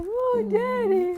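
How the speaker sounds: very high, slow, loud swoon; gushing affection. Retold or imitated. Actress reacting to her screen dad's sweet message, gushing. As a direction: melt into a drawn-out adoring coo.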